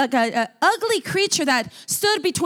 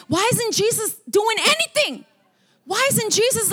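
mild mock anger, pitched up; retold exasperation; 6 semitones higher than usual. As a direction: complain in imitated frustration. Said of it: "from frustrated to mad".

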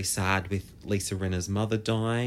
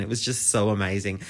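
usual speaking voice

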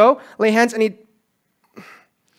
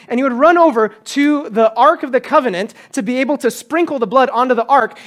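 normal talking voice

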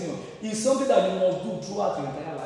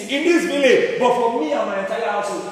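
higher, climbing louder line by line; fiery building sermon; rising preaching; 5 semitones higher, 5 dB louder than usual. Real, not acted. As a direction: build the list to a peak. Preaching at full force.

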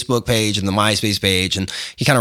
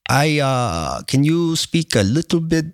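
higher, halting imitation of a French speaker; French accent; 4 semitones higher, slower than usual. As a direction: imitate a Frenchman struggling with English. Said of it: "Oh, are you from France?".

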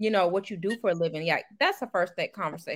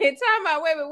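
excited; 6 semitones higher, 3 dB louder, faster than usual. Real, not acted. Interrupting excitedly.

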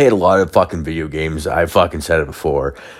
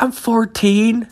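put-on much higher, slow girlish voice; young girl voice; slower than usual. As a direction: squeak out the age innocently. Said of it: "She's like".

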